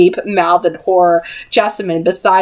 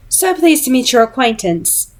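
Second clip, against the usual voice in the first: ordinary delivery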